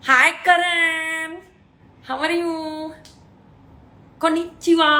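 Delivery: high, loud, slow hi to a friend; drawn-out happy hello. Real, not acted. Greeting Karan in the live chat, slow, high and loud. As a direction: stretch the greeting out warmly.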